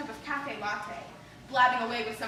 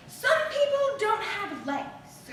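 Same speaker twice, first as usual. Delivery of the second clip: a light hint of a grandmother, slow and a bit higher; offhand old-world wisdom, shrugged off; grandmother's shrug; 6 semitones higher, 4 dB louder, much slower than usual. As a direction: grandmother waving off a complaint. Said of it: "she'd shrug and say".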